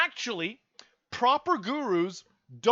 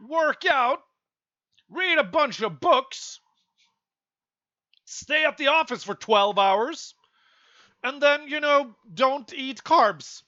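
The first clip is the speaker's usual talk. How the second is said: higher and louder, mocking the advice; heated rant; 5 semitones higher, 4 dB louder than usual. Real, not acted. Vlogger mocking guru routines, heated rant. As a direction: rattle off their rules in disgust.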